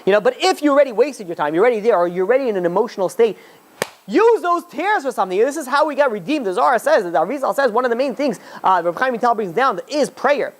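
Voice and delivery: high, impassioned preaching voice; stirring sermon climax; fervent preaching. Acted. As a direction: rouse the listeners to cry and pray. Impassioned call to pray.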